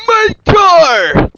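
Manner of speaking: loud, high yell; big hammed-up cry of protest; outraged shout. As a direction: holler in mock alarm. Car owner sees it taken.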